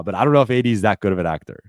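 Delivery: ordinary talk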